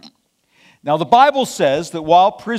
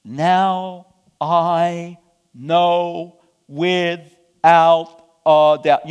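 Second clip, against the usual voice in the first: very slow, "Now... I... know... without... a doubt"; drawn-out declaration with long held words; exclaimed, word by word; 5 dB louder, much slower than usual. Acted. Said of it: "Peter exclaimed".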